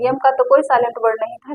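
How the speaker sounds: ordinary delivery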